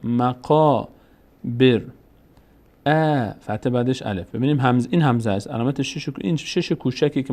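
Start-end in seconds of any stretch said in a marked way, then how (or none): 0.0-1.8 مقابر slow, with a drawn-out qaa; long alef sound. Shown as a demonstration. Teacher repeats after giving the rule, long held alef. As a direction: hold the alef in مقابر before the final syllable. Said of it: "صدای آ".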